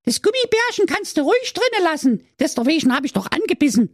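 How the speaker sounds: very high, fast cheeky mermaid voice; sassy mermaid. Acted. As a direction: snap at the prince like a saucy mermaid.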